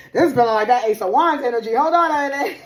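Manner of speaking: real excitement, loud, high and fast; excited outburst. Real, not acted. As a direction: burst out loud and high, breaking into a laugh. Excited repeat of same words.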